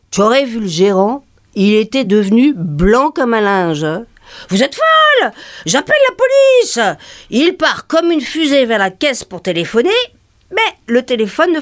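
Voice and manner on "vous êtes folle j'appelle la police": a much higher, panicky yelp; the manager shrieking for the police; high-pitched frightened outburst; panicked manager. Retold or imitated.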